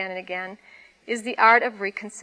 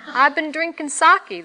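a loud, higher bellow; 6 semitones higher, 7 dB louder than usual. A narrator's reading. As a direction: bellow it back at the old man. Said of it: "the laborer bellowed back".

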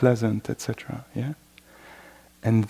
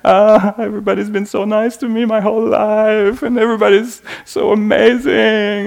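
higher and louder, a gushing, overwhelmed thank-you; gushing gratitude; 9 semitones higher, 8 dB louder, faster than usual. Retold or imitated. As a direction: play someone swept away by gratitude, bubbling over. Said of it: "lost in the gratitude".

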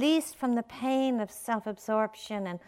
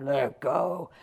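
low, booming command; God voice; 6 semitones lower than usual. Acted. God instructing the clinging man, deep booming voice. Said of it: "And the voice booms out".

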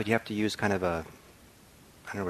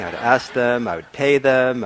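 mock exasperation; 3 semitones higher, 11 dB louder, slower than usual. Real, not acted. Exaggerating to stop chatter.

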